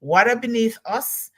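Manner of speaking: normal talking voice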